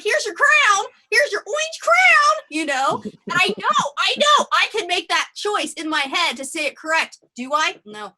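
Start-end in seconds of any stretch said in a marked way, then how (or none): 0.0-2.4 put-on high, loud singsong to a toddler; babysitter voice. Babysitter chirping high and loud at the two-year-old. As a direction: hand the toddler his crown, loud and sing-song. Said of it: "every day I'm like".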